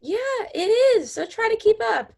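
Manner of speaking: high, fast snippy comeback; snippy retort. Acted. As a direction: snap back, high and quick. Acted quarrel, snippy.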